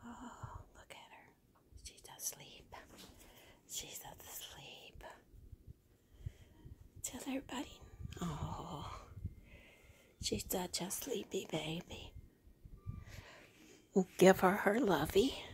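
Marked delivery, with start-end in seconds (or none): 0.0-2.7 whispering. Real, not acted. Hushed over sleeping doll. 3.7-5.0 slow, soft whisper. Real, not acted. Repeated, slower whisper. 7.0-12.1 whispering. Real, not acted. Hushed over sleeping doll.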